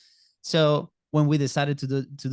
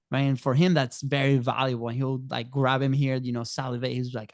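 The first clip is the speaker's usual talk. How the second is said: ordinary delivery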